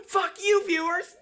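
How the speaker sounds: high, loud jab at the audience; comic high-pitched outburst; mock hostile shout. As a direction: yell it as a sarcastic punchline. Climax of sarcastic rant.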